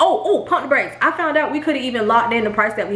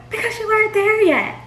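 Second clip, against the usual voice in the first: much higher mocking voice of the realtor; 11 semitones higher than usual. Wife mimicking the realtor's excuse, high and mocking. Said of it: "She said".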